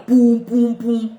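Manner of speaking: loud booming poum for passing trucks; mock lorry rumble; truck noise. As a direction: thump it out like heavy lorries. Imitating trucks passing at night.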